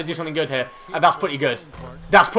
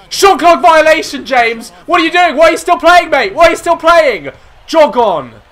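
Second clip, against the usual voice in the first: loud, high yell at a teammate; frustrated yell; 7 semitones higher, 8 dB louder than usual. Real, not acted. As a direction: scold the AI teammate loudly. Yelling at teammate in game.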